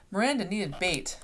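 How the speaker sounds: ordinary delivery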